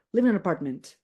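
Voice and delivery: live in an apartment as livinanapartment; linked speech. Shown as a demonstration. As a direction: run live in an apartment into one word. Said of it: "As if it's only one word".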